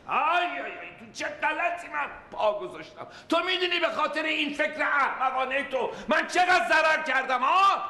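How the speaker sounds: furious high shouting father; angry yelling. Acted. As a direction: roar at them in rage. Father yelling at his family. (فریاد خشمگین پدر سر خانواده)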